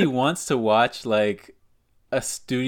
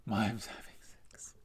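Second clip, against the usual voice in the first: hushed, voiceless echo; barely-there whispered repeat; 2 semitones lower, 14 dB quieter than usual. Pretend. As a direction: whisper it as if keeping silent. Echoes joke about silent lovers.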